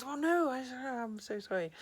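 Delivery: high, loud burst of hammed-up remorse, then slowing; mock dismay. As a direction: apologize in exaggerated horror. Retold husband regrets striking his wife.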